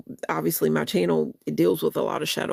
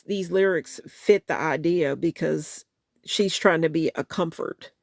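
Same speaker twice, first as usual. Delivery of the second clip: normal talking voice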